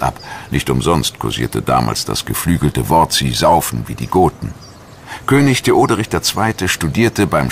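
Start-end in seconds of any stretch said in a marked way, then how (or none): none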